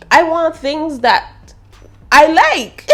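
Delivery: whiny insistence. Real, not acted. Insistent whine.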